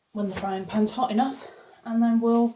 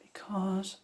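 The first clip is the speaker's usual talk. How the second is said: whispering; 9 dB quieter than usual. Real, not acted. Hushed aside while pan heats.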